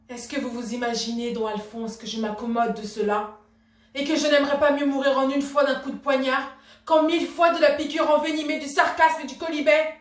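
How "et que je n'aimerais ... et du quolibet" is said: louder, higher, vehement protest; indignant outburst. Acted. Wife protesting to don Alphonse at being mocked, indignant outburst. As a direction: build to a ringing, offended protest.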